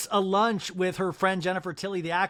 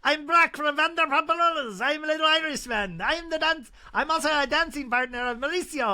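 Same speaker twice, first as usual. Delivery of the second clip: put-on silly Irish accent, much higher; bad Irish accent; 8 semitones higher than usual. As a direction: ham up an Irish dancer voice. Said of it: "I don't do accents".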